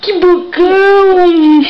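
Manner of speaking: high, slow, gushing praise; delighted baby talk. Acted. Mother cheering her baby's big bite, high and slow. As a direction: squeal it out to the baby, drawn long.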